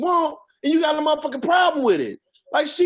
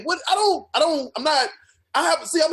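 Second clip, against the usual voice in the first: ordinary talk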